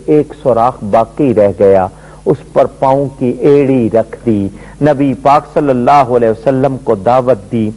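ordinary talk, nothing marked

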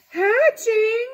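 very high, loud squeal of alarm; shocked squeal. A narrator's reading. Elephant shrieking at news of hatching eggs, high and loud. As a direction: shriek the word in disbelief.

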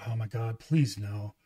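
low, hushed inner plea; quiet dismay. Retold or imitated. Dad hearing his daughter's bad news, quietly dismayed. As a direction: sink into soft, retold dread.

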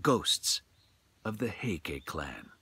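measured, hushed storyteller read; slow, grave storytelling voice; story narration. A narrator's reading. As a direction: tell it like a ghost tale by lamplight.

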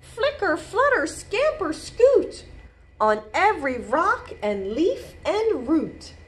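high, lively storybook rhythm; bouncy picture-book narration; reading to children. A narrator's reading. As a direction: pop each action word up high. Reads picture book aloud.

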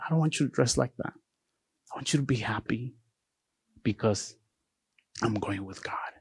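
soft, tender retelling of a dying woman; hushed last wishes. Retold or imitated. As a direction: let it sink gradually to a near whisper.

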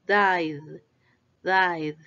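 died in one syllable ending on a buzzing D; low-pitched died with a voiced final D. Shown as a demonstration. Teacher models the D ending, voiced D on died. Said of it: "Ahora vamos con la D".

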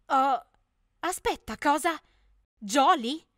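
loud, high disbelieving cry; shocked cry. Acted. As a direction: blurt it out in shock. (grido incredulo e acuto)

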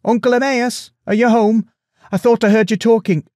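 high, loud, youthful hail; calling in. A narrator's reading. Young prince calls to his uncle from the doorway, high call. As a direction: call out high to someone indoors. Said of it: "a voice called in from the doorway".

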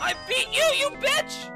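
high taunting yell; raging gamer. Real, not acted. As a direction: yell at the boss.

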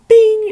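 a sudden very high, very loud "bing"; mock ding sound. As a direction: ring it out like a cartoon bell. Light bulb pops idea.

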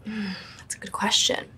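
whispered, slow, mulling it over; hushed musing whisper; thoughtful whisper. Real, not acted. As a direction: breathe the words while thinking. Pondering a fan question.

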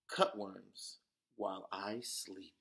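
plain reading voice

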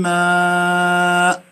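ماء held very slowly with nasal meem; drawn-out recitation. Shown as a demonstration. Teacher models meem ghunna. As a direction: stretch ماء long after من. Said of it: "ما في داعي".

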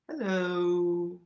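ordinary delivery

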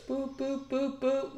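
high, soft boop boop sound effect; mock beeping noise. Imitating the chart ticking on stream, high and soft. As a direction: chirp it like a ticker. Mimicking price ticks on chart.

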